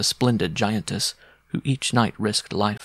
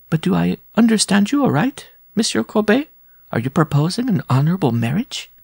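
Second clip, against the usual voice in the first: much higher, astonished big woman; incredulous giantess; 10 semitones higher, 3 dB louder than usual. Acted. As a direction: ask in disbelief, high. Giantess asks in disbelief.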